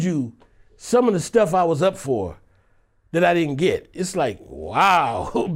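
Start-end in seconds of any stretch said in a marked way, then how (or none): 4.4-5.5 drawn-out amazement. Acted. Amazed at roles he missed.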